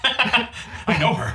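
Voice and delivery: laughing. Acted. Mock fan reaction, laughing.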